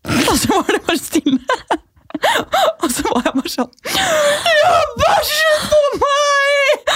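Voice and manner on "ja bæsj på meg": much higher, slow wailing sob-shout; mock-tearful wail to her mum; crying out. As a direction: blurt the confession while bursting into tears. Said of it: "Og begynte å gråte".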